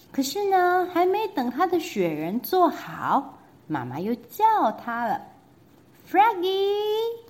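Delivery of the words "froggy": high call with a voice unlike the narrator's own; mother calling her son back again. Acted. Mother calling Froggy from the house, high call. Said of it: "called his mother".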